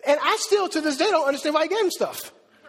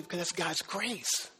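gentle answer; 8 semitones lower, 11 dB quieter, slower than usual. Retold or imitated. Quiet answer to his kids.

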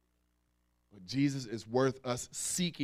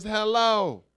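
loud mock hello; 6 semitones higher, 7 dB louder than usual. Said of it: "you get attitude at the drive-thru".

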